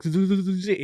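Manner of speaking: high teeth-chattering stammer; shivering stutter. Retold or imitated. Reciting the alphabet for the cop while freezing, chattering stammer. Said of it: "I'm freezing".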